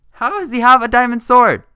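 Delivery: high loud shocked outcry; shocked shout. Real, not acted. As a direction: shout it in disbelief. Shocked at enemy's weapon.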